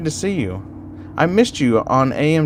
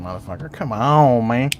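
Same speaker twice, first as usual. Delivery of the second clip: ordinary talk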